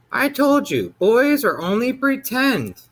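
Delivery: high, slow mother's voice, dismissive; a monster mom brushing off her son; impatient mother. A narrator's reading.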